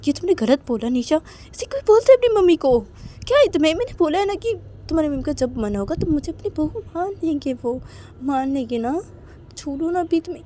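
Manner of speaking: high, loud reproach; acted scolding. Manisha निशा को डाँटती है. (ऊँची आवाज़ में डाँटते हुए)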